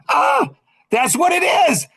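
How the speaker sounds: loud, very high eureka cry; acted breakthrough. Told the missing last word, high excited exclamation. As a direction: burst out as if the missing piece just landed. Acting out his breakthrough moment.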